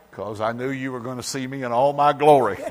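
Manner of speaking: ordinary delivery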